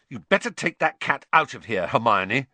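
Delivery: loud, high-pitched angry order; furious. A narrator's reading. Boy nursing his toe, ordering the cat out, furious. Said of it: "said Ron furiously".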